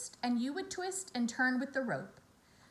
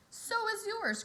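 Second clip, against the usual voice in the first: crying out; 11 semitones higher, slower than usual. Acted. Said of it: "cried Avery".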